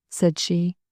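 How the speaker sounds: plain reading voice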